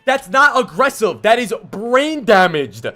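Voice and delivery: angry rant. Real, not acted. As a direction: yell it out, fed up with his feeding. Ranting at player's bad play.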